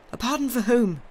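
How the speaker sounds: loud, high, urgent demand; cried question. A narrator's reading. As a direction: cry it out as a startled question. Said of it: "he cried".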